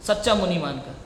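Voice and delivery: ordinary talk, nothing marked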